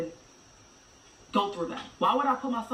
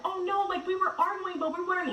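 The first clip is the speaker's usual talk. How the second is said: much higher mocking whine; mocking the ex's excuse; 11 semitones higher than usual. Mother imitating the ex lying about their fight, mocking. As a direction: sneer at her lame excuse.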